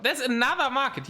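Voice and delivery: high-pitched, astonished discovery; surprised. Real, not acted. Caster spots a second market on the map, surprised. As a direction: blurt it out in real surprise.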